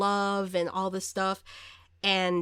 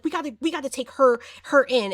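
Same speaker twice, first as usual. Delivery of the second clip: fast, loud, raised-pitch urgency; retold worked-up officer; 5 semitones higher, 7 dB louder than usual. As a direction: rush it out, acting all mad. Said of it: "she's getting all mad".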